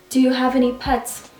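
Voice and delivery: ordinary talk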